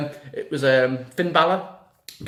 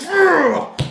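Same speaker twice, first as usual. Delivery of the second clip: high, loud frustrated groan; fed-up groan while steaming over the result; disgusted groan; 13 semitones higher, 7 dB louder than usual. Retold or imitated. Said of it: "I was like".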